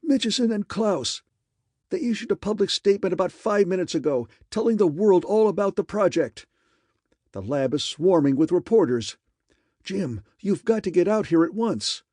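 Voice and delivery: high, loud urgent announcement; excited. A narrator's reading. Raymond telling Harker the secret is out, high loud excitement. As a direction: spill the news breathless and insistent. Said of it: "this excited".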